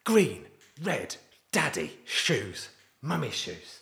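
put-on high, slow little-girl naming words; father mimicking daughter naming colours and shoes; imitating toddler. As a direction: sound out words like a tiny child. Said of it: "She's like".

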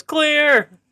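very high call dropping at the end; high-pitched imitation warning shout; retold warning yell. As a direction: yell it out like a warning, now certain. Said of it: "I think he goes".

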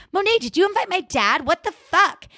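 high-pitched mock outrage; outraged confrontation. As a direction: act out an indignant, incredulous demand. Julian storms down, confronts Monet.

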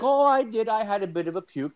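hammed-up high Irish drinker; old Irish guy. Imitating Tommy admitting he puked, high-pitched and quick.

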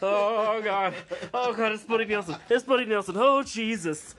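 high, loud, breathless fan gushing; starstruck freakout. Pretend. Fan meeting her idol Buddy Nelson, high starstruck squeal. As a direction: gush like you just spotted your idol. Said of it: "I'd be like".

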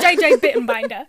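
loud comedian impression on the edge of laughter; John Mulaney. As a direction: echo the catchphrase, very loud and amused. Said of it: "give me a classic John Mulaney line".

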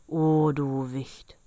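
put-on slow, drawn-out menacing old giant; fearsome old man's cry. A narrator's reading. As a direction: call out slowly as a dreadful old man. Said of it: "rief er". (langsamer, drohender Ruf des Alten)

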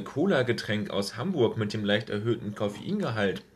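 ordinary talk

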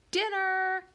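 high, loud dinner call. A narrator's reading. Mom calls the family to dinner from the kitchen, high loud cry. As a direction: holler it high through the apartment. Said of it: "a cry came from the kitchen".

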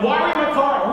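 high, heated accusation; retold angry confrontation. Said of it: "that much anger going on".

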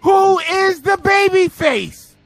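shouting rant. Real, not acted. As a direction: shout the outrage at the bookers. Ranting about the storyline.

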